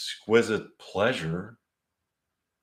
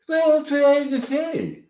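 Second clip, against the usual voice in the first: much higher, cheerful put-on waitress voice; Maud's voice; 5 dB louder than usual. Maud answers the couple, high cheerful character voice. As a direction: sing it out high through puffed cheeks. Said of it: "Okay, this is Maud's voice".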